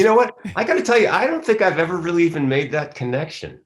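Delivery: high, loud burst of realisation; real amazement. Real, not acted. Actor struck by his character's name connection, amazed. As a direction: blurt it out, astonished by the discovery.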